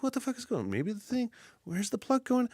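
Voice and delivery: high, soft puzzling-it-out voice; mock calm, rational. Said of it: "like a calm, rational person".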